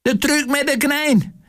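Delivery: piercingly high magician's announcement; high, strained showman cry; shrill screech. A narrator's reading. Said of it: "snirpte de goocheloer".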